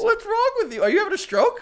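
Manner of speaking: high, loud, laughing disbelief; amused disbelief. Real, not acted. Friend reacting to a botched word, laughing disbelief. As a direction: tease them through laughter, pitched high.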